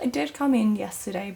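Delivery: normal talking voice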